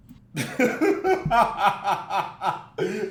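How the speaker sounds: long, loud real laughter. Real, not acted. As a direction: crack up at what you just heard. Laughs at her yelling story.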